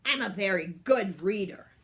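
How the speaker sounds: a wolf showing off his reading; boastful wolf. A narrator's reading. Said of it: "growled the big bad wolf".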